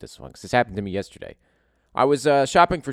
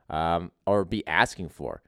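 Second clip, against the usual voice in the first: ordinary talk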